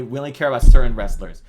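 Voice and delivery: a sudden loud sarcastic outburst; mock loud admission. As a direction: mockingly shout the company's real stance. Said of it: "when you say".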